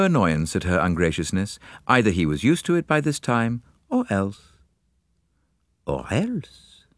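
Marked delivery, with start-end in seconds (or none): 5.8-6.9 higher, softer, rising echo; musing thought. A narrator's reading. Poirot speculating about the colonel, soft musing. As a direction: let it hang softly as a private thought. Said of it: "thought Poirot".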